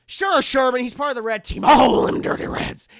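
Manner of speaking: loud, hammed-up agreement; exasperated humoring shout. [name] humoring Sherman about the red team, exasperated humoring shout. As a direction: holler it, getting rougher by the end.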